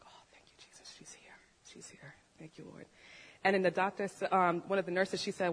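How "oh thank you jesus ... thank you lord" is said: very soft whisper of relief; barely voiced, reverent whisper; whispered thanks. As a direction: re-enact a hushed, grateful prayer on waking. Retold thanks at baby's arrival.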